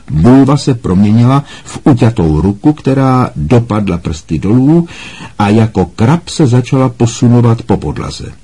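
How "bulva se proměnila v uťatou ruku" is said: ordinary delivery, nothing marked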